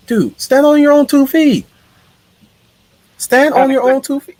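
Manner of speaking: high-pitched scolding outburst; mock exasperation. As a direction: squeal it in comic disbelief.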